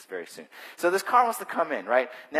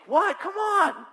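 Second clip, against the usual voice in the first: much higher, louder outraged cry; indignant protest; 6 dB louder, much slower than usual. Retold or imitated. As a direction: protest the pay cut, outraged. Penalized worker protesting.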